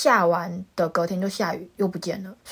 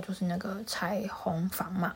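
conspiratorial whisper; soft unvoiced whisper; 12 dB quieter, slower than usual. Real, not acted. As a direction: whisper it like a secret aside.

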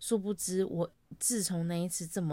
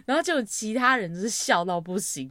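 ordinary talk

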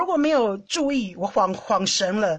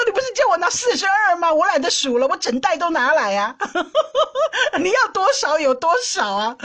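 high and louder, laughing through the words; laughing retort; 7 semitones higher, 4 dB louder than usual. Retold or imitated. Retorting to her husband over the cups, laughing. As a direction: tease him while you laugh.

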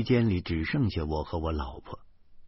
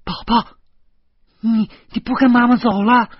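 loud, high startled cry; shocked dismay; 12 dB louder than usual. A narrator's reading. Mother shocked as her child slips out of the lift, alarmed. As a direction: call out in alarm, stumbling on the first word. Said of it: "琳娜大惊失色".

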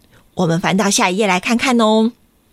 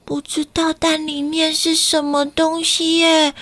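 put-on gently puzzled child; lightly voiced little boy; 6 semitones higher than usual. Kakai hiding behind a tree, lightly puzzled. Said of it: "他都说".

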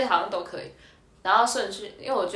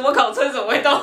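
惊讶大叫; 7 semitones higher, 6 dB louder than usual. Retold or imitated. 车突然动了吓到.

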